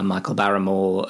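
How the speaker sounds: ordinary talk, nothing marked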